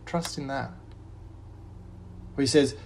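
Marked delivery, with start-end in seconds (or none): 0.1-0.7 soft refrain. Acted. Pastor closing each verse with a soft refrain. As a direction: drop to a soft, settled close.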